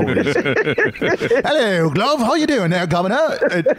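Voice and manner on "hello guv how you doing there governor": high-pitched, hammed-up Cockney greeting; exaggerated Cockney, pitched way up; over-the-top Cockney accent. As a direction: play a ridiculous Cockney to embarrass your daughter. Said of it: "most over-the-top Cockney accent".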